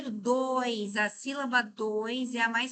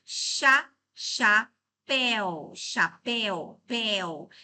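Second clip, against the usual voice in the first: split into syllables; 4 dB louder, much slower than usual. Shown as a demonstration. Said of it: "Vamos analisar cada uma aqui?".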